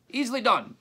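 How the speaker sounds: high, loud answering call; calling back. A narrator's reading. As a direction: pitch it up and call across the dark. Graveyard traders calling to each other.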